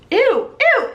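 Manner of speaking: high loud ew; disgusted ew. Retold or imitated. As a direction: squeal it grossed out. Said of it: "and he's like".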